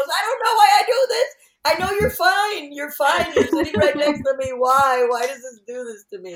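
high, fast hammed-up sobbing complaint; mock crying. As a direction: whine through mock tears at yourself. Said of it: "She goes".

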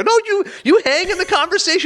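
very high-pitched protest; indignant scolding. Retold or imitated. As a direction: scold a friend who bailed on you. Retold scolding of Billy.

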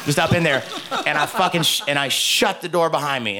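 ordinary talk, nothing marked

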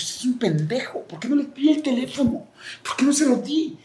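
voz mucho más aguda y extraña reprochándose; regáñate con incredulidad; autorreproche. Retold or imitated. Se reprocha a sí mismo. (se regaña por no haberle dado el teléfono, más agudo)

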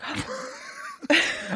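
high mock gasp; sharp, acted intake of breath; shocked gasp. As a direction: gasp as if scandalised. Acting out her shocked reaction.

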